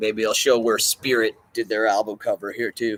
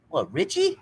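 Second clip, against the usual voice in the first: much higher put-on voice; Lewis Lewis. Retelling the coma scene, high mock surprise. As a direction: pipe up surprised in a cartoon voice. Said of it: "He's like".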